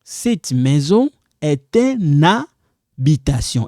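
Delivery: slow, "est une" linked as one sound, ɛ-tyn; liaison demo. Shown as a demonstration. Teacher shows learners the liaison, slowly and deliberately. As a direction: carefully link "est" into "une". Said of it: "You also do like liaison".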